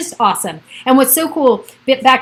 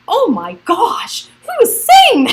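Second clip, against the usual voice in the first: much higher, louder, squealing disbelief; shocked disbelief; 11 semitones higher, 6 dB louder, much slower than usual. Retold or imitated. Mocking the gloomy divorce book to live viewers, aghast. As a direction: gasp out your outrage at the advice.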